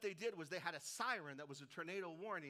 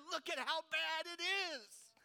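doubters' outcry; 12 semitones higher, 11 dB louder than usual. Acted. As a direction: shout it as an indignant doubter, stretching the words. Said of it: "because they say".

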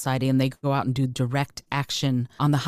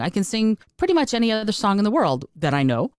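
ordinary delivery

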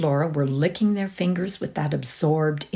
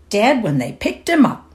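louder, higher relish; 7 semitones higher, 7 dB louder than usual. A narrator's reading. Delivery man relishing his story to the girls. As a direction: savor the gory detail. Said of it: "said Godber's man with relish".

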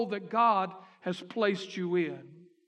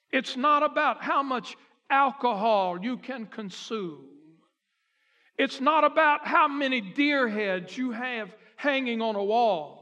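preaching at full force; 4 dB louder than usual. Acted. Preacher to the fathers of the church, loud and building. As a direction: hammer each "it's not about" to the congregation.

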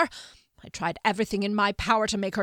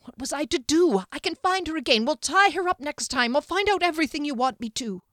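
much higher, louder, pleading whine; shaking whine; 10 semitones higher, 6 dB louder than usual. A narrator's reading. Servant on his knees begging the wizard, high and whining. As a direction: beg on your knees, whining. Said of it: "the shaking whine in his voice".

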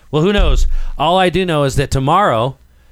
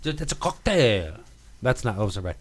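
ordinary talk

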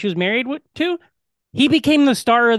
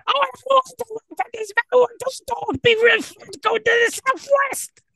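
high-pitched Cockney accent impression; Pearl's Cockney accent. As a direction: imitate her terrible Cockney accent, pitched way up. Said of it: "She has, like, a terrible Cockney accent".

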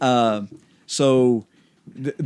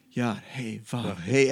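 soft breathy Yah hey Vav hey, much softer; the divine name said as pure breath; 3 semitones lower, 6 dB quieter than usual. Shown as a demonstration. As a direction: breathe the syllables out like a sigh. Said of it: "as he is speaking".

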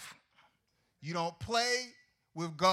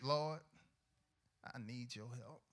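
much softer, small voice; quiet plea; 7 semitones lower, 12 dB quieter than usual. Pretend. Believer begging God for help, very soft. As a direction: murmur it humbly. Short mock prayer.

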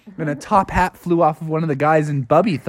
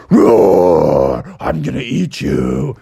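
much lower, growling monster roar; scary bear voice; 11 semitones lower, 3 dB louder, much slower than usual. Retold or imitated. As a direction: growl a menacing bear threat. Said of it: "people were hearing it as".

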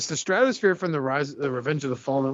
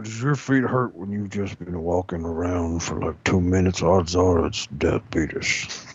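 Wilford Brimley voice; 9 semitones lower than usual. Retold or imitated. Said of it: "'Cause I'm Walter Brimley".

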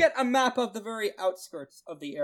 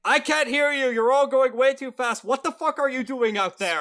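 raised, higher voice calling from afar; shouting over wind; 5 semitones higher, 5 dB louder than usual. Acted. Bystander shouting at speeding travellers, loud call. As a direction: holler it at someone racing past.

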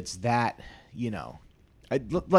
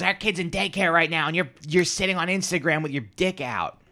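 mock angry wife, higher voice; imitating angry wife; 8 semitones higher, 4 dB louder than usual. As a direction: play the furious wife, pitched up. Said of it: "she was really pissed".

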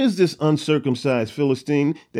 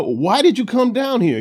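raised, higher angry challenge; older brother scolding David, raised and angry; 9 semitones higher, 4 dB louder than usual. Retold or imitated. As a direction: snap at the little brother, higher and louder. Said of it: "he gets angry".

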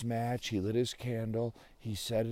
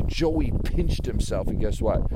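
ordinary delivery

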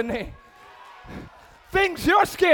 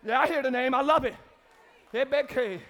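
ordinary talk

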